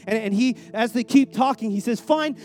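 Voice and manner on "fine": louder, higher burst of surrender; exasperated giving in. Retold or imitated. Hungry Esau trading birthright to Jacob, exasperated. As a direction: cave in loudly, acting out a hungry man.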